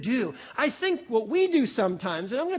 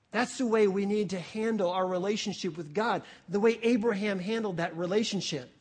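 ordinary talk

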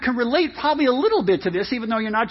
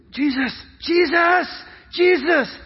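high, drawn-out pleading calls; urgent calling; 6 semitones higher, slower than usual. Acted. Disciples waking Jesus in the storm, urgent high calls. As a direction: keep calling to rouse a sleeper.

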